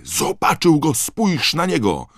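high, loud cry of discovery; excited yell. A narrator's reading. Harry points out the chase to his friend, yelling. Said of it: "wrzasnął Harry".

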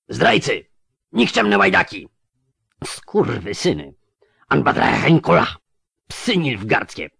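high, loud outraged cursing; furious elf curses. Acted. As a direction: hurl the insults at the traitors. Elves curse their betrayers.

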